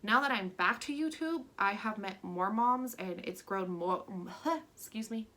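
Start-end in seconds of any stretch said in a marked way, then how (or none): 4.1-4.7 a short real giggle that breaks off the sentence; giggle fit. Real, not acted. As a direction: laugh at yourself for losing the words. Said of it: "can't talk".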